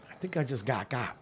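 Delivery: soft, hushed aside. Retold or imitated. Telling Maritza in the car he got played, hushed. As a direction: murmur it so he can't hear.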